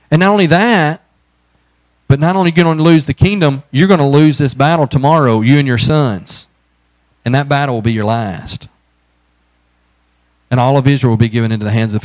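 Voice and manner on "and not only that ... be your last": loud, driving preacher's warning; fervent preaching. Acted. Pastor warning the congregation of Saul's fate, loud and driving. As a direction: press the doom home with full force.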